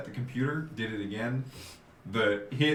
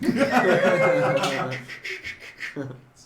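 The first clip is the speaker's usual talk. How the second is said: loud extended laugh; long laugh; 6 semitones higher, 6 dB louder than usual. Real, not acted. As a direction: really crack up. Laughing at Fallout joke.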